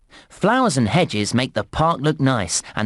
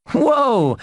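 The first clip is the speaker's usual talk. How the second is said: high, drawn-out wow of amazement; amazed; 9 semitones higher than usual. Acted. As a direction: let out an impressed, high-pitched whoa. Amazed at the inspector's reaction.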